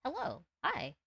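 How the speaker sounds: high little cringe-voice greeting. Shown as a demonstration. Streamer trying out the voice, high cringe greeting. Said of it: "once I do the voice".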